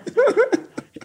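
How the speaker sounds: high peal of laughter; laughing. Real, not acted. Laughing at the story.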